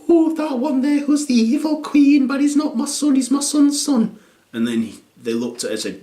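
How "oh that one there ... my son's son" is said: high, mock proud gran; lightly voiced mum. Mum answering parents at the panto, high and proud. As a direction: pipe up like a vain young grandmother.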